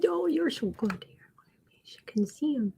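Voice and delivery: soft whisper to the cat; hushed tender whisper. Real, not acted.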